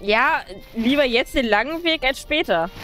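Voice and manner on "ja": normal talking voice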